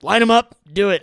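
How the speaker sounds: high, loud order; loud command. Retold or imitated. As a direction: call it out like a drill. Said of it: "he's like".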